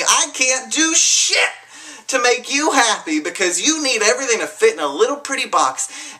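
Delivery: reliving a furious rant at the world; angry rant. Retold or imitated. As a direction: let the old rage pour out. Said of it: "That was my anger".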